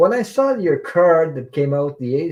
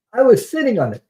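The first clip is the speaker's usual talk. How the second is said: amazed; 10 semitones higher, 6 dB louder, faster than usual. Retold or imitated. Reliving her astonishment at the coins.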